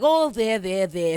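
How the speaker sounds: pat him on the head with fake comfort; mock soothing Lestat. Lestat consoling Louis mockingly.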